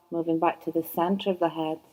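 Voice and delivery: ordinary talk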